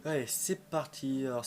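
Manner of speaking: usual speaking voice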